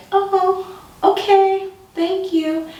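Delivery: high, nervously polite; high-pitched awkward thanks; nervous politeness. Retold or imitated. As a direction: chirp it out to be nice. Nervous polite reply to the cop.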